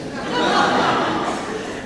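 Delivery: breathy high laugh. Real, not acted. Laughs at own irony.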